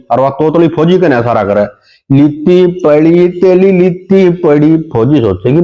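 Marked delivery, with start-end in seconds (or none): 2.1-4.8 high, slow lisping singsong; lisping girl mocking the soldier in song. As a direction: sing it with a childish lisp. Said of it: "एक तोतली".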